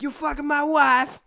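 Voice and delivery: high, loud mock-outraged foreigner voice; hammed-up shouted accusation; Borat. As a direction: yell it in a comic put-on accent. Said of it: "He's, like, in the car".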